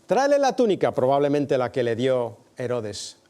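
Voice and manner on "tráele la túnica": mocking order. Soldiers' order in Passion retelling.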